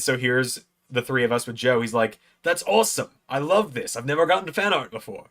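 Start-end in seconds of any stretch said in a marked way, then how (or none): 2.4-5.3 higher, swooping wrestler impression; Joe Hendry. Wrestler receives fan art, impersonated delight. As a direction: imitate a delighted star, pitched up. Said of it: "not the best Joe Hendry impression".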